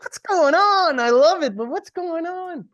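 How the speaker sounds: high, loud, baffled exclamation; bewildered. Retold or imitated. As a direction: throw up your hands in amused confusion. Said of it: "I'm like".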